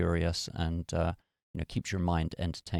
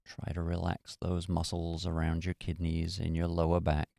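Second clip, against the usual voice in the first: guided meditation. Acted. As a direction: ease them into stillness. Leads a meditation.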